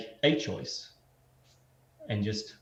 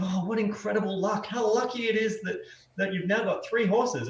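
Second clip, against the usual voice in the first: much higher, gushing neighbor; amazed neighbor; 10 semitones higher than usual. Acted. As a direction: marvel at the luck like a nosy neighbor. Neighbors marvel in parable.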